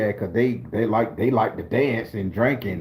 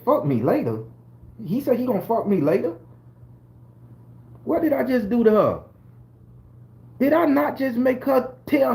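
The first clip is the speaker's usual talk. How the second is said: much higher, offended woman's voice; outraged woman; 11 semitones higher than usual. Acted. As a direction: squeal it like a scandalized woman.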